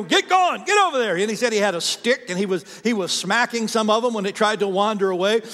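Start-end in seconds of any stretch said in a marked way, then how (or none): 0.0-1.2 high, loud yell at the sheep; shepherd yelling. Retold or imitated. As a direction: bark orders at the flock, pitched way up. Shepherd driving sheep with rocks.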